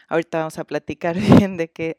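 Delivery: amused, quick burst of laughter; laughing. Real, not acted. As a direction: laugh as the words tumble out. Laughs while previewing topic.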